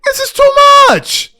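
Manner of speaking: high loud exasperated outburst; a fed-up cry. Retold or imitated. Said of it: "I'm like".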